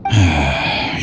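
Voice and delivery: long weary sigh before confessing; heavy sigh. Acted. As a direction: let out a tired, resigned breath. Old man admitting guilt.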